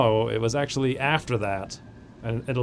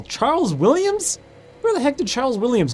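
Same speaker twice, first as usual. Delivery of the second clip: surprised; 9 semitones higher than usual. Real, not acted. Unexpected recruit name.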